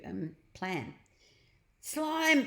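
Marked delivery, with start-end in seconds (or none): 1.8-2.4 high, louder shout for Slime. A narrator's reading. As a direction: call out while falling. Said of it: "he shouted".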